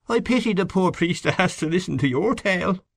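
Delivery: high-pitched wife's character voice; concealed satisfaction. A narrator's reading. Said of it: "wiser to conceal her satisfaction".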